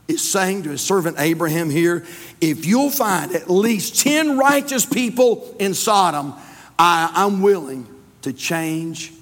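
slow down and drive the point home; forceful pulpit voice with drawn-out words; emphatic preaching. Real, not acted.

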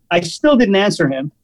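ordinary talk

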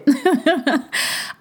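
bright high laugh; happy laugh. Real, not acted. Host amused at her own gushing, bright high laugh. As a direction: let the laugh bubble out.

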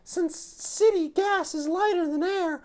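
put-on high, confident young woman; Jolene voice. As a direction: explain the plan with smug confidence. S0 voices Jolene explaining plan.

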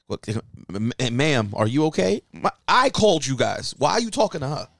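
rising from hesitant to heated; calm turning angry. Retold or imitated. Retold argument with police.

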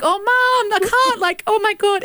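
very high mock teenage whine; exasperated protest to mum. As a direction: protest to mum in a squeaky, pleading pitch. Acted whining protest to mum.

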